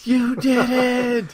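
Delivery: high, drawn-out squeal of delight; mock overjoyed. Man reacting to a surprise lifting belt, mock overjoyed. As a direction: stretch it out high like a thrilled kid.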